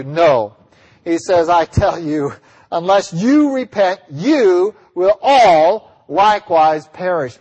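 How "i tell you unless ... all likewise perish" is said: slow warning, leaning hard on "you" and "all"; emphatic. Acted. Jesus warning all his listeners, emphatic. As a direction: stretch "you" and "all" out with weight. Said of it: "Emphatically, all of you, plural".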